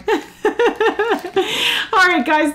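high, giggling farewell; laughing goodbye. Real, not acted.

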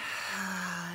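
long breathy exhaled sigh; breathing-exercise sigh; audible sigh. Shown as a demonstration. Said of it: "let it out with a sigh".

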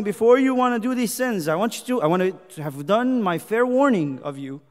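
loud sermon warning; urgent preaching. Acted. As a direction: preach a fair warning in God's voice.

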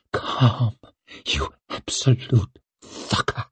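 near-whispered self-rebuke; muttered. A narrator's reading. Woman scolding herself in a whisper. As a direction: hiss it at yourself under your breath. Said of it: "she muttered at herself".

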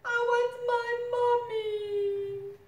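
high, loud, slow whiny wail; mock howling. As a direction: wail for mommy like a baby. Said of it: "wailed and howled".

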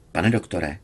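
plain reading voice

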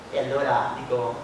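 ordinary delivery

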